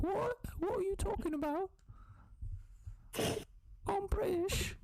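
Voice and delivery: very high mock British accent; baffled British kid in a tiny falsetto; British boy. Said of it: "And I'm British for some reason".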